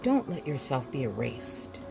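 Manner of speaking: usual speaking voice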